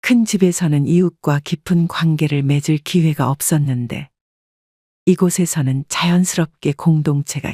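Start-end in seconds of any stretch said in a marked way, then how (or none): none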